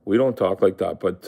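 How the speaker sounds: ordinary delivery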